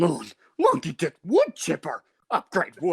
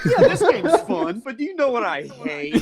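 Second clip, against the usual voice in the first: mock Roberto; 7 semitones higher, 3 dB louder than usual. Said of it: "He's like".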